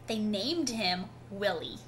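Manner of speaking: ordinary delivery, nothing marked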